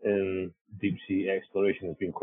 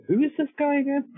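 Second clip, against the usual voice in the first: high, louder mock-incredulous question; 6 dB louder than usual. Teller acting out everyone's amazement at a humble colleague, incredulous. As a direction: blurt it in exaggerated disbelief.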